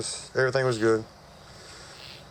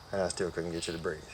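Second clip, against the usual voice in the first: soft, lowered voice; subdued retold confession; 5 semitones lower, 8 dB quieter than usual. Surgeon recalling patient's collapsed chest, subdued retelling. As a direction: let it drop low and quiet.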